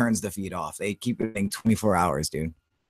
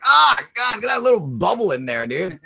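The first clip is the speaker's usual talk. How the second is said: disgusted outcry; 9 semitones higher, 5 dB louder than usual. Retold or imitated. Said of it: "I'm like".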